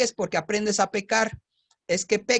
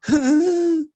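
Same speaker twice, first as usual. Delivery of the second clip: mock baby cry; 8 semitones higher, 6 dB louder than usual. Mimics baby cry after quote.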